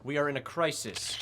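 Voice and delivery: loud opening over a crowd, then settling; official addressing press. Acted. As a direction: project like a politician at a podium.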